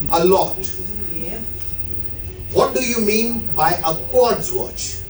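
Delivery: ordinary delivery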